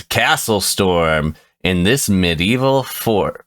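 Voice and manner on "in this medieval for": ordinary talk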